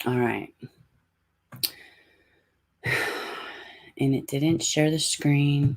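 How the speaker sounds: ordinary delivery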